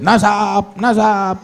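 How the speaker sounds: high, drawn-out mock cry; mock shrieking. Preacher shows what the Prophet's descendants never did, mock shrieking. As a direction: imitate someone crying out their pedigree. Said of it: "nggak jerit-jerit nasab".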